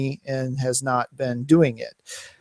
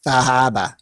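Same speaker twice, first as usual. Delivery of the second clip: New England accent; 2 semitones lower than usual. Shown as a demonstration. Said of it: "correct New England accent".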